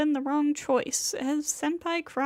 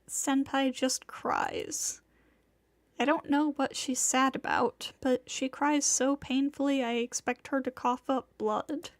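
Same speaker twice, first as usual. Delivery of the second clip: usual speaking voice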